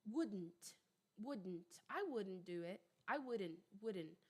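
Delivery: WOULDN'T with a barely perceptible D; low, soft WOULDN'T, the D almost gone; American pronunciation. Shown as a demonstration. Teacher demonstrating American pronunciation to students, D nearly silent in WOULDN'T. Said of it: "o D, ele é quase imperceptível".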